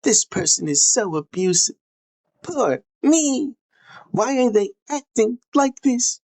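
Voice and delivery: high, whiny put-on poor-me voice; exaggerated high-pitched self-pity; mock victim abuser. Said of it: "and then saying".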